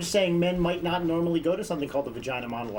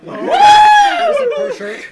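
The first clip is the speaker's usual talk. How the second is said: very high, loud, slow, laughing question; excited shriek; 15 dB louder, much slower than usual. Real, not acted. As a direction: squeal it out in disbelief.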